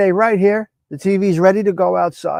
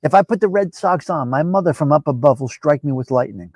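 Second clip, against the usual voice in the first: ordinary delivery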